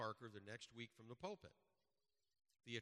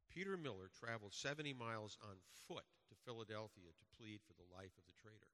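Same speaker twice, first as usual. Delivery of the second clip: normal talking voice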